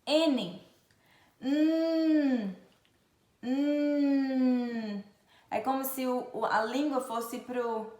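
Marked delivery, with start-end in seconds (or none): 1.4-5.0 "Hum" as a long "nnn"; isolated N sound. Shown as a demonstration. Teacher showing the N sound, a sustained "nnn". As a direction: hold "nnn", tongue behind the front teeth. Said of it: "atrás do dente da frente".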